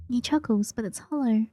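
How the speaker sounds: ordinary talk, nothing marked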